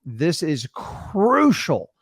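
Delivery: "crucial" drawn out, falling; slow emphasis on crucial. Shown as a demonstration. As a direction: lean hard on "crucial", very slow. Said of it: "use that tonality to emphasize points".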